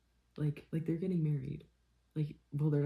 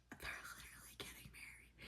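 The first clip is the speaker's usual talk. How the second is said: whisper; 21 dB quieter than usual. Real, not acted. Whispered repeat.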